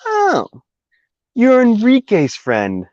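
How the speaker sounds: high, slow, sing-song clerk; drawn-out fake-sweet gossip; mocking lady voice. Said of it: "the lady goes".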